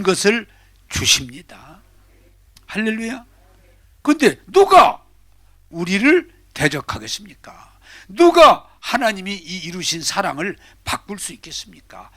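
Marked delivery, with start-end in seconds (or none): none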